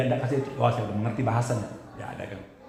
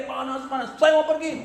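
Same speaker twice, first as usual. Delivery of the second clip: high, pleading mock yell; retold cry; 13 semitones higher than usual. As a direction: imitate the possessed person crying to get away. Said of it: "dia mulai teriak".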